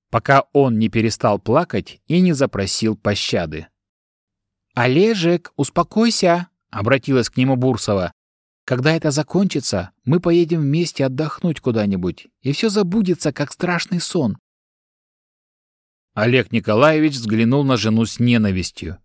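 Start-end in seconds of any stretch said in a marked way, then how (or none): 4.8-6.5 high, gentle coaxing to a husband; calm him down tenderly; soothing. Acted. Wife soothing hysterical husband. 8.6-14.4 soothing. Acted. Wife soothing hysterical husband.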